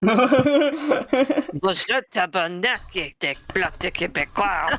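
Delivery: high, loud, laughing mock Québécois; mock Québécois accent; French Canadian accent. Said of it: "a French Canadian as bonjour".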